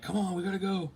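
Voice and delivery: high, pressing call to go; urgent hurry-up. Retold or imitated. Urging Amelia and his parents to run, high urgent call. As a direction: hurry your family along.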